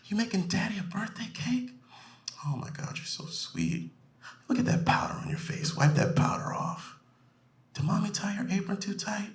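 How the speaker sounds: put-on high, soft, whispery baby talk; soft, high doting-father imitation; doting daddy voice. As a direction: coo to a little daughter. Friend voiced talking to toddler.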